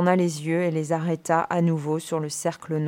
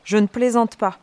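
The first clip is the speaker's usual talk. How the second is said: firm warning, louder and higher; voix élevée; 5 semitones higher, 6 dB louder than usual. A narrator's reading. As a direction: raise your voice in warning. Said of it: "dit Dake en élevant la voix". (avertissement d'une voix forte)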